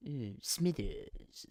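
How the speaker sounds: put-on scheming boss; Mister Burns. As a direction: draw the name out slowly and softly. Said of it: "Do an impression of Mister Burns".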